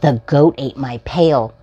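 bright, sing-song picture-book reading; reading to children. Acted. Host reading a picture book to young viewers, storytime voice. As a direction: read it out like storytime for little ones.

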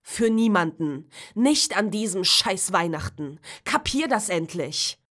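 loud, bitter shouting; teenage son lashing out at Christmas; angry yelling. Acted. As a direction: throw the words at them in anger. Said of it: "brüllte Tom".

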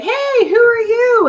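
high, slow mock-friendly jab; teasing. Shooter teasing the shy newcomer, high and mocking. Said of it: "and teasing me".

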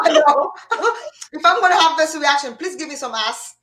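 high-pitched, through real laughter; laughing. Real, not acted. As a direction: plead jokingly while still cracking up. Both cracking up at the joke.

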